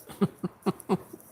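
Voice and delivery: imitated high chuckle; knowing little chuckle in retelling; priest's chuckle. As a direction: chuckle lightly like an amused priest. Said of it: "he, he chuckled again".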